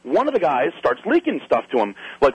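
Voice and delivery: usual speaking voice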